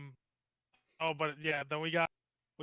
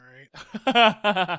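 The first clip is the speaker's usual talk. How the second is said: loud laughing all right; laughing shout; 2 semitones higher, 13 dB louder than usual. Real, not acted. Telling his friend to shut up, laughing loudly. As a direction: bark it out through a laugh.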